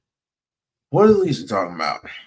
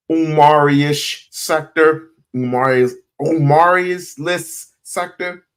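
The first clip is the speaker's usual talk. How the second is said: slow and drawn out; 3 semitones higher, slower than usual. Shown as a demonstration. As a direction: stretch each word out. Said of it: "Really slowing it down".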